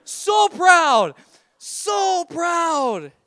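hammed-up high, loud proud wail; overcome with pride. Dad wrecked by son's words, tearfully proud. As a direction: cry it out, drawn out and falling. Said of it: "wrecked me".

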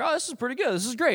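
high, delighted little self-cheer; pleased self-talk. Acted. Young pitcher to himself while doing pretty well, pleased.

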